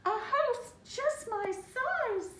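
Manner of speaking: high, slow squeak of delight; delighted mouse squeak. Acted. The mouse thrilled to find a tiny house, squeaking high. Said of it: "Mortimer sighed with delight".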